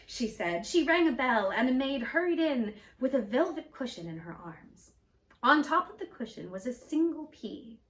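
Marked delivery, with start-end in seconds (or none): none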